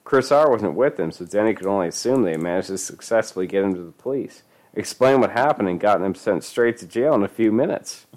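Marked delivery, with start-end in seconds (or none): none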